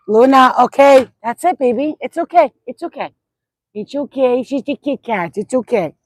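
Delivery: sing-song reassurance to a scared pet; soothing pet talk. Real, not acted. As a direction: coo at the puppy to calm her. Soothing dog.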